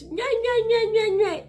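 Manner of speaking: high, loud nya-nya mockery; mocking nagging. Mocking people who keep nagging her. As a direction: parrot the nagging as babble. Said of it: "the more people kept".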